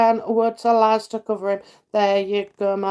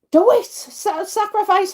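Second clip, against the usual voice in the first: emphatic urging; 8 semitones higher than usual. Real, not acted. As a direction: punch it as a firm nudge. Urging viewer to take holidays.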